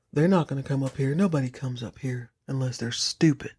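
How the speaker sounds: low, soft eerie caretaker voice; creepy low groundskeeper. Retold or imitated. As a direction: mumble it like a creepy old groundskeeper.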